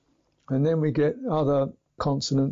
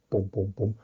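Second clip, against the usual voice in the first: lower, dull "boom, boom, boom"; 4 semitones lower than usual. Shown as a demonstration. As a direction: thud it out like a padded drum. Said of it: "a stick with a ball on it".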